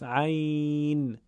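عین with the ey held long and slow; stretched ey in عین. Shown as a demonstration. Teacher isolating the word to show the madd. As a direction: draw out the ey of عین. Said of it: "یه یا داره ساکن هست".

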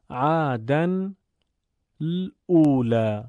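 slow syllable by syllable reading; slow reading aloud. Shown as a demonstration. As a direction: read it out slowly as an example. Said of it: "مثال کتاب رو". (خواندن آهسته)

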